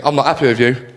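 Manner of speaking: low, grave telling-off; stern dad. Acted. Dad home from parents' evening, sternly disappointed. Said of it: "Have dads coming home from parents' evening".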